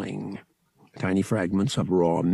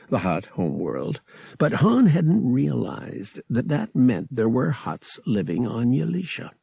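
ordinary delivery, nothing marked

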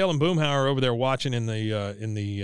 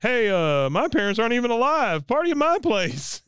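high eager Bill; 10 semitones higher than usual. Retold or imitated. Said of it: "He goes".

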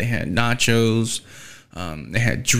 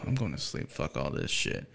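fed-up mutter; 7 semitones lower, 14 dB quieter than usual. Retold or imitated. Retold fed-up exit to bed.

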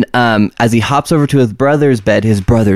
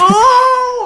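shrieking nervous laugh. Real, not acted. Terrified laugh at ghost story.

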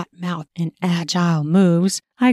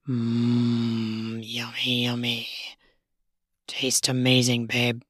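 lower, softer, savoring man's voice; low male lover voice; 8 semitones lower, 8 dB quieter, slower than usual. Acted. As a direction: murmur low like a lover tasting.

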